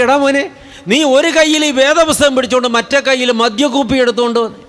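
shocked father. Retold or imitated. Father shocked at liquor bottle.

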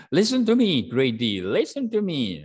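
loud, high, bossy order; sharp demand to be listened to; impolite command. Shown as a demonstration. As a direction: bark it like an impatient teacher. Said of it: "Instead of saying".

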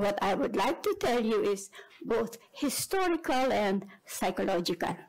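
ordinary talk, nothing marked

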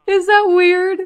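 high, laughing, half-crying squeal. Real, not acted. Vlogger asking viewers if proposing is weird, laughing squeal. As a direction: squeal it through giggles. Laughing at her own plan.

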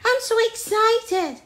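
put-on high, loud excited young ape; excited exclamation. A narrator's reading. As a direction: burst out with storybook excitement. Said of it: "Jim exclaimed".

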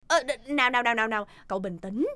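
quiet soothing voice for an upset friend; soothing calm. Acted.

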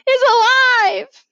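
high, loud, drawn-out shriek. A narrator's reading. Teacher spots a louse in horror, long high shriek. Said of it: "a sudden shriek".

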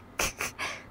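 soft, breathy chuckle; real giggle. Real, not acted. Streamer amused by morbid idea, soft chuckle. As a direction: let a hushed laugh out.

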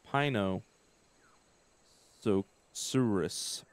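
"Pino-, so-, Saurus" in slow separate chunks; "Pino-, so-, Saurus" with pauses between the parts; sounding it out. Real, not acted. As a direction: work out "Pino-, so-, Saurus" piece by piece. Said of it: "Is that how you say?".

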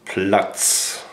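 Platz with a crisp pl and ts ending; German Platz. Shown as a demonstration. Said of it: "Down in German is Platz".

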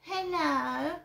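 rising mock greeting; mild sarcastic imitation; mocking put-on hello. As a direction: parody of a neighbour's hello. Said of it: "I don't speak like that".

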